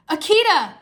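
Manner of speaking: high, loud call of a name; crying out. A narrator's reading. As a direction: greet a sister with a glad shout. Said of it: "he cried".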